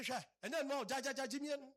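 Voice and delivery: high, loud, rapid-fire repetition; urgent retold warning.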